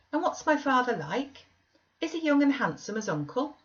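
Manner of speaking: put-on high, curious child's questions; a boy keen to hear about his father; eager young boy. A narrator's reading. Linton voiced as a child.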